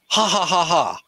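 stiff, evenly spaced mock laugh; awkward fake laugh. Fan mimicking a film character's laugh, awkward and forced. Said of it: "it seems really awkward".

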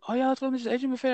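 high, fast eager mock voice; mock friends. Friends suggest the buffet, fast eager mock voice. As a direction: rattle off the invite like an eager friend. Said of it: "every time they're like".

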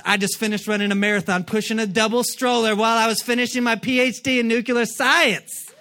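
mock overachiever brag. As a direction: rattle off the feats proudly. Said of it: "She does everything".

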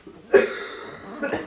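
sharp high coughing; hacking cough from a tickle; coughing fit. Real, not acted. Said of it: "it's coming on again".

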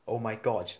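ordinary delivery

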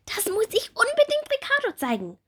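loud, high excited call; excited little bumblebee. Acted. Bumblebee Bommel rushing in to the doctor, high excited call. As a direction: burst in eagerly. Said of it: "ruft Bommel".

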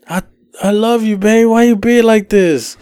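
put-on high, slowed drunken whine; drunk pleading boyfriend.

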